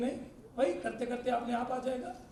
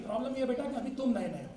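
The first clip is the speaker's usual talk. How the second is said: ordinary talk, nothing marked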